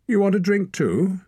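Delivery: very high, loud challenge; baiting. A narrator's reading. Torak baits Hord near the fire, very high and loud. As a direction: bait him with it.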